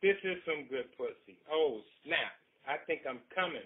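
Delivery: hammed-up ecstasy. A man at his climax, loud mock ecstasy. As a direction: ham up the climax. Character climaxing, read aloud.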